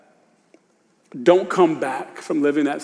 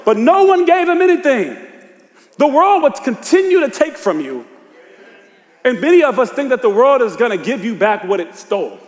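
raised, high-pitched preacher's cry; high, urgent sermon delivery; full-force preaching; 7 semitones higher than usual. Acted. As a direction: preach it out to the whole congregation.

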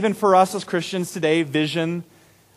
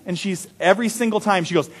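normal talking voice

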